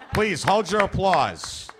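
ordinary talk, nothing marked

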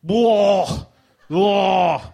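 loud, hammed-up ugh; mock exasperated ugh; disgusted groan. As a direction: groan like it's unbearable.

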